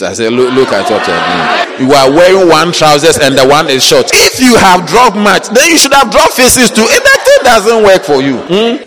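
normal talking voice

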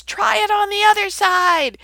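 high, loud call across the water; hollering. Retold or imitated. Jesus hollers to disciples out fishing, loud high call. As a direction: holler it out to the others far off. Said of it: "he hollers at 'em".

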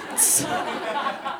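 quick unvoiced high giggle; a stifled laugh; breathy giggle. Real, not acted. Narrator laughing before quoting sister, giggle.

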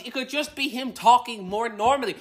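very high, impassioned outburst; crazy rant. Real, not acted. Podcaster arguing for Danny DeVito as Mario, crazy rant. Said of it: "this rant has got a bit crazy".

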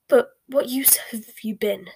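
scornful, sneered question; sneering. A narrator's reading. Bellatrix questions Snape's usefulness, sneering. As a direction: challenge him with a sneer. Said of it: "sneered Bellatrix".

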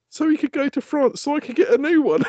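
put-on high, sweet little-boy voice; innocent child. Son explains he let hamster go to France, high child voice. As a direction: play the kid's cheerful logic.

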